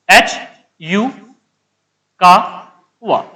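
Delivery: H, यू, कावा in separate pieces with long pauses; slow name dictation. Real, not acted. Teacher writing the scientist's name, piece by piece dictation. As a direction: break up H यू कावा, stretching कावा slowly. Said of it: "इधर लिख देता हूं".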